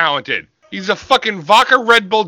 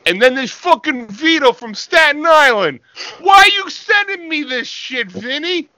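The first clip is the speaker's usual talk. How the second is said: much higher shouted cartoon voice; mock meathead; 9 semitones higher than usual. Co-host as Vito complaining to his friend, high mock shout. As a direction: yell it like a dim wrestler.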